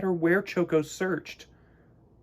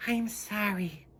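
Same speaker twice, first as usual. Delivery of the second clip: slow, soft higher sigh; sighing penguin; 6 semitones higher, 4 dB quieter, slower than usual. Acted. Missus Penguin sighing to Choco, slow and soft. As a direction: breathe the apology out gently. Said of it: "sighed Missus Penguin".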